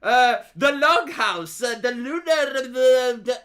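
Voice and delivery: loud, drawn-out mock lecturing; university-changed pundit. Mocking Sargon back from the university, loud slow imitation. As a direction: imitate him, drag out each pet term loudly. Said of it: "who speaks like that".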